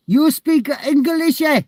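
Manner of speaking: slow high broken English in a put-on foreign accent; drawn-out comic foreign-accent question; slow loud mock foreign accent.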